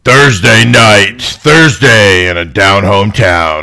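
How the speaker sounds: slow dramatic announcer voice; movie trailer voice. Pretend.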